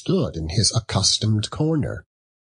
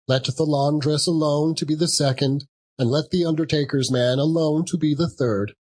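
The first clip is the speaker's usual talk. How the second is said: gleeful charwoman; 7 semitones higher than usual. A narrator's reading. Charwoman continues her gleeful listing.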